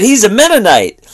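high, loud mock telltale; retold accusation, pitched right up; tattling. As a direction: imitate a guy gleefully ratting out his friend. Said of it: "He kinda ratted him out".

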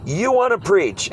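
high, drawn-out challenge to a heckler; showman's incredulous comeback; mock incredulity. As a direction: play up the disbelief, slowly. Acting his comeback to a heckler.

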